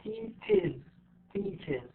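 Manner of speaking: "teaches" ending in a separate iz; extra IZ syllable. Shown as a demonstration. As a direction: softly show "teaches" as teach-iz. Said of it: "فأنا عم بلفظها إي زد".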